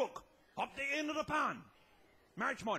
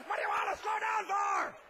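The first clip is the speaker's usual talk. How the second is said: yelled back; 7 semitones higher, 4 dB louder than usual. Retold or imitated. Brother yelling back at speed.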